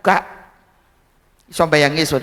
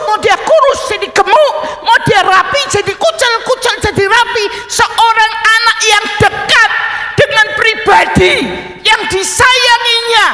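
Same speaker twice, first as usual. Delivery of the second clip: loud, much higher, drawn-out preaching; fiery preaching; 13 semitones higher, 7 dB louder than usual. Acted. Preacher driving home a child's love for his father, loud fervent preaching. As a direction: pound each phrase home from the pulpit.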